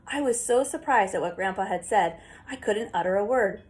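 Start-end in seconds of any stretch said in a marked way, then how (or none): none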